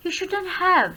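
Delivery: soft, letting "have" sink down; falling intonation. Shown as a demonstration. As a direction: ease off the force and drop on "have". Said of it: "अपने जो voice है". ("have" पर धीमा, गिरता लहजा)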